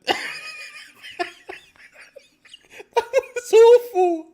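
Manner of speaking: high, loud, slow words through helpless laughter; laughing. Real, not acted. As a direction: laugh it out in disbelief. Laughing disbelief at defending.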